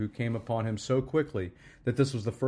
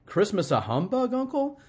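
much higher, rising sharply at the end; incredulous question; 9 semitones higher, 4 dB louder than usual. A narrator's reading. Nephew challenging his uncle over Christmas, incredulous. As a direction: question him in high-pitched disbelief. Nephew disbelieves Scrooge's remark.

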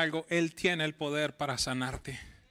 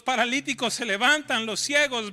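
higher, much louder climactic preaching; preaching at full force; 6 semitones higher, 6 dB louder than usual. Acted. Pastor proclaims Jesus's miracles to the church, fervent and loud. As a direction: proclaim the miracles like a rising wave. Climax of sermon, music under.

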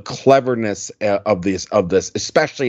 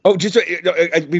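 usual speaking voice

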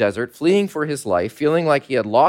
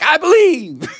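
higher, louder mock revival cry of belief; dramatic convert; 12 semitones higher, 5 dB louder, slower than usual. Nathanael proclaims belief in a hammed-up dramatic conversion. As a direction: ham up a Southern Baptist conversion. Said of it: "He's like".

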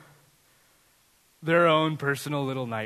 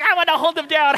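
put-on excited little girl; 11 semitones higher, 8 dB louder, faster than usual. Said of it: "She's like".

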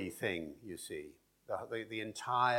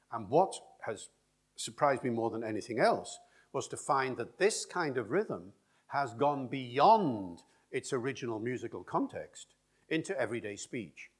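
ordinary talk, nothing marked

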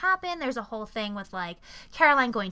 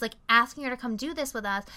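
ordinary delivery

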